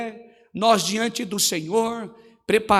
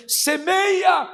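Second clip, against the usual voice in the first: fervent preaching; 5 semitones higher than usual. Real, not acted. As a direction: cry it out to the church. Preaching at full force. (pregação em brado, aguda e forte)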